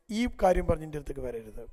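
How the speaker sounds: stern refusal. Retold or imitated. Father firmly refuses daughter.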